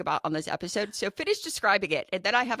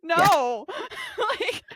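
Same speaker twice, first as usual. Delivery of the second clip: emphatic no; 11 semitones higher, 4 dB louder, slower than usual. Retold or imitated. Firm reassurance to sick kid.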